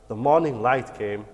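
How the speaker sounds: usual speaking voice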